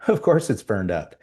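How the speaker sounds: weary of-course shrug, pitch climbing; resigned shrug. Retold or imitated. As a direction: shrug it off like nothing could be different. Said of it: "Chief's reaction would be".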